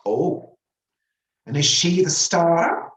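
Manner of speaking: high, slow, loud question; oblivious cleaning lady. A narrator's reading. Cleaning lady asking if she's the star, loudly oblivious.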